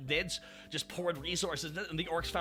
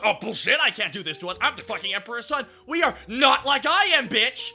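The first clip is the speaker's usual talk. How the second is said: louder, scornful shouted retort; angry mockery; 6 semitones higher, 10 dB louder than usual. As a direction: throw their words back at them. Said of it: "He's like".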